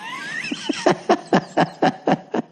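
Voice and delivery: helpless high-pitched cackle; real laugh, wheezing up high. Real, not acted. Comedian cracking up at the lady's mistake, wheezy laugh. Laughs at his own impression.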